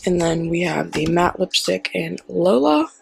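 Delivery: ordinary delivery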